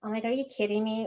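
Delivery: ordinary talk, nothing marked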